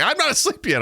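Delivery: imitating wife. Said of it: "She's like".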